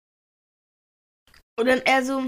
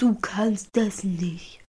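retold whisper; 3 semitones lower, much slower than usual. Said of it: "Der auch so".

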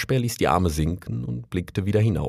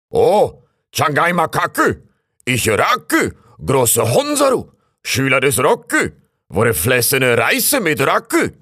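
loud, high call up into the treetops; 7 semitones higher, 5 dB louder than usual. A narrator's reading. Raccoon calling up to a rustling treetop, loud and high. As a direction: call out grandly, pitched high. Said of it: "rief er".

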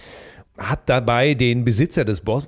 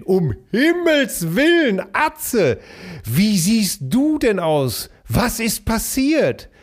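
put-on high, slow horrified girlfriend voice; shocked woman; 8 semitones higher, slower than usual. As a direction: gasp it out in high alarm. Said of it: "das entsetzte Gesicht meiner lieben Freundin". (hohe, langsame, entsetzte Frauenstimme)

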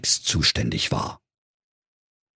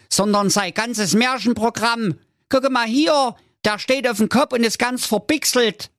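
exasperated brother, climbing from usual pitch to very high; Jakob scolding; 10 semitones higher than usual. Acted. Jakob sets his dim brother straight, scolding rising to squeaky high. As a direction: start level, then rise into high comic exasperation. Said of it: "gab seinem Bruder eine Kopfnuss und sagte".

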